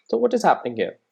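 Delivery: usual speaking voice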